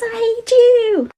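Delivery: high, slow mocking jeer; mock taunt. Brother jeering at the ghost from the bridge, high. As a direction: taunt the ghost sing-song.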